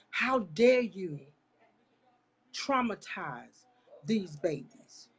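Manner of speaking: slow, outraged rebuke; real anger. Real, not acted. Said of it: "Y'all, I'm angry right now".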